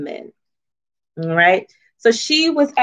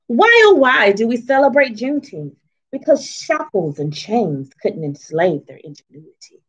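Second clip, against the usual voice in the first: put-on reading to children. Reading her book aloud.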